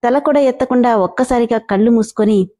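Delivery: ordinary delivery, nothing marked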